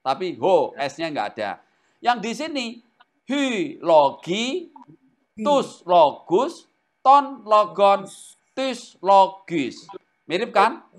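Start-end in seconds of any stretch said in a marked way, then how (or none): 3.3-9.8 very slow, each Greek form spelled out; drawn-out classroom recitation of case endings. Shown as a demonstration. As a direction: chant the endings one by one for students. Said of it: "reciting Greek declension forms".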